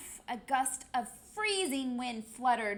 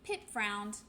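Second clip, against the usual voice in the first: usual speaking voice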